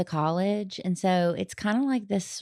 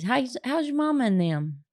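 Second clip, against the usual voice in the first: higher-pitched Southern greeting; Southern phrase; 6 semitones higher than usual. Shown as a demonstration. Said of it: "That's a Southern phrase".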